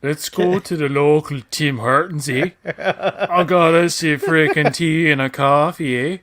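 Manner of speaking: hammed-up Canadian drawl, slow on the coffee line; mock Canadian accent. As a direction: parody Canadian buddy inviting a friend to Tim Hortons, slowly. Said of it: "sound like an exaggerated version of Canadians".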